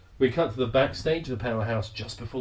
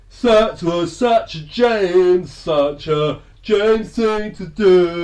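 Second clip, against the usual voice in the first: mock drawl; 5 semitones higher, 8 dB louder, much slower than usual. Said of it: "And so he's going".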